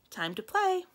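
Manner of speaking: high-pitched playful invitation; coaxing a pet. Acted. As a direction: call a pet to play. Coaxing the bird to play.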